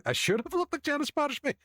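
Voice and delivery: very high, incredulous repeat; mock indignation. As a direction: squeal it back in mock outrage. Indignant repeat of the same remark.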